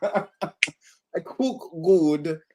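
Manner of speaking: put-on very slow, drawn-out Caribbean accent. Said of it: "Caribbean accent".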